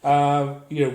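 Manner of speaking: ordinary talk, nothing marked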